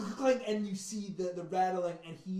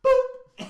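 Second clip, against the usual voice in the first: high, loud comic boop; playful poke noise; 16 dB louder than usual. Acted. Game master mimes the finishing rapier blow, comic boop. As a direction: pop out a sudden cartoon sound effect.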